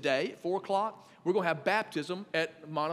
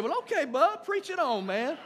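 delighted; 8 semitones higher, 4 dB louder than usual. Retold or imitated. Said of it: "I said".

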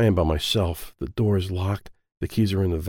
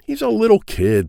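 loud high plea; pleading; 13 semitones higher, 5 dB louder than usual. Acted. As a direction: beg him to stop. Ed pleads with Felipe.

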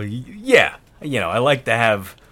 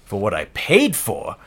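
usual speaking voice

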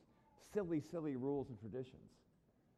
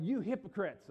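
loud, higher rebuke; worked up; 5 semitones higher, 6 dB louder than usual. Acted. Jesus rails at the Pharisees while disciples watch, worked up. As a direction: call out the accusation, worked up. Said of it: "maybe even yelling when he said this".

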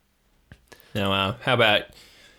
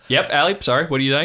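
higher, distracted uncle voice; Uncle Marv; 7 semitones higher, 6 dB louder, faster than usual. Uncle returns to his nephew's call, raised and distracted. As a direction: pick the call back up, raised and apologetic. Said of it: "you go back to Marv".

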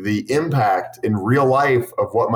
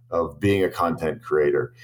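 ordinary talk, nothing marked

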